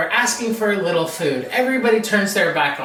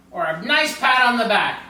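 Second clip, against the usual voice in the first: plain reading voice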